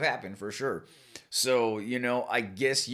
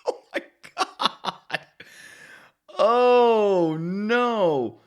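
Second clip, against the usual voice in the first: breathy, laughing Oh my God, then louder Oh no; laughing disbelief; 8 semitones higher, 9 dB louder, much slower than usual. Real, not acted. Vlogger reacts in laughing shock to the cancer accent story. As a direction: gasp it between laughs.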